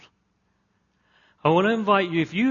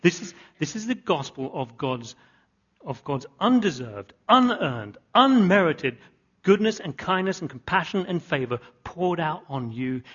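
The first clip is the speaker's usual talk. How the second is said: slow preacher's underline; emphatic list. Shown as a demonstration. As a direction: hammer each word of the list. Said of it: "Let me just underline that again".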